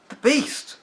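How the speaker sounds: high frightened cry; boy spotting blood, crying out in fear; cried out. Acted. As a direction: gasp it out in alarm. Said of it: "cried Charlie".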